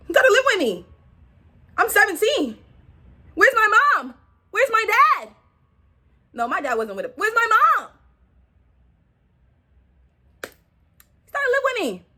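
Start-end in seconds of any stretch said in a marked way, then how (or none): 0.1-5.3 mock shock. Shocked retelling of moving in. 7.1-12.0 mock shock. Shocked retelling of moving in.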